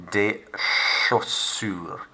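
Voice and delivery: "chaussures" said show-sure, slow and even; slow, syllable by syllable. Shown as a demonstration. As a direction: break the long word into syllables. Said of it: "so we take our time".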